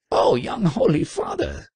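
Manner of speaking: high, wailed outcry; mock sobbing. A narrator's reading. Fainted trader to the young monk, acted sobbing. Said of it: "sobbed the trader".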